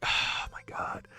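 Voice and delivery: sighed, whispered and slow; breathless whisper. Real, not acted. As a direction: let it out on a breath. Stunned after the reveal.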